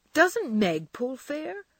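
indignant. Acted. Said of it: "looking indignant".

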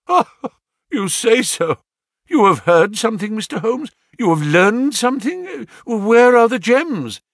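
high, breathless, pressing questions; eager excitement. Acted. Desperate banker pressing Holmes for news, high and eager. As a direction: grab at the hope of news.

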